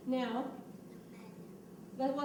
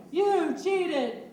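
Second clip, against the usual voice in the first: loud accusing yell; angry yell; 2 semitones higher, 7 dB louder than usual. Shown as a demonstration. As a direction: yell it at them angrily. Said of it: "He yelled".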